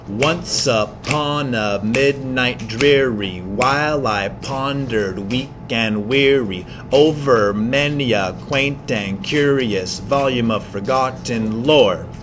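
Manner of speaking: slow, low dramatic reading of a poem; poem recitation. Shown as a demonstration. Teacher reading Poe to the class, slow and dramatic. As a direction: read it slow and spooky over the music.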